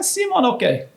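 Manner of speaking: high, lukewarm nod of approval; grudging restaurant owner. Retold or imitated. Owner grudgingly rating the player's game, high-pitched and lukewarm. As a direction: give a shrugging, so-so compliment up high.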